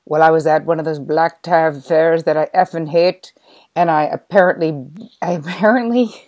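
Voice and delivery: loud, put-on Irish accent, poorly done; hammed-up Irish brogue, shouting it out; bad Irish accent. Golfer explaining the auction in a loud bad Irish accent. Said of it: "I can't do an Irish accent".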